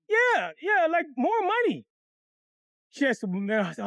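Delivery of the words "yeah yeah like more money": high, hopeful acted answer; eager pleading. Boy answers woman's offer of something else, eagerly pleading.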